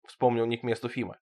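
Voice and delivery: plain reading voice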